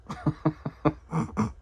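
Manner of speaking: soft, high chuckling laugh. Real, not acted. Storyteller amused by his own prank, soft high laugh.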